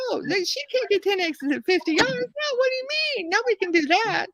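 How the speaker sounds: high, disbelieving pitch jump; an old guy who won't buy it, high and doubting; incredulous old man. Retold or imitated. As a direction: scoff like you can't believe it. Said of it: "And he said".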